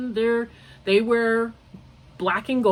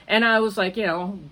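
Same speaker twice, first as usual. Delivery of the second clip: ordinary talk, nothing marked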